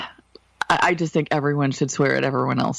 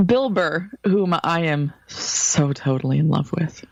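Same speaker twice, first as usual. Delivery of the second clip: usual speaking voice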